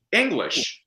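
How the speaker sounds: ordinary talk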